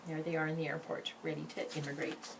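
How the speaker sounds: ordinary delivery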